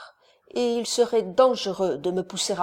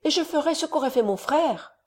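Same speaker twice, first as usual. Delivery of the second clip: eager, higher-pitched promise; effusive; 7 semitones higher than usual. A narrator's reading. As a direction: pledge it with gushing feeling. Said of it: "s'écria Everard avec effusion". (promesse pleine d'effusion)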